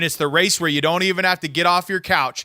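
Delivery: ordinary talk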